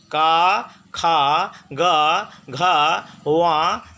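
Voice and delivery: each syllable का खा गा घा spoken separately and distinctly; clear syllables. Shown as a demonstration. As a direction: recite का खा गा घा crisply, one by one. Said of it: "ये स्पष्ट उच्चारण है".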